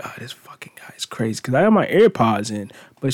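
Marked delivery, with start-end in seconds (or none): 0.0-1.4 soft, fast whispered aside about a stranger; lady on the train whispering about him; whispered judgement. Retold or imitated. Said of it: "she's like".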